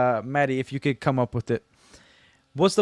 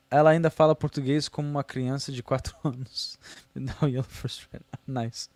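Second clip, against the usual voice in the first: ordinary talk, nothing marked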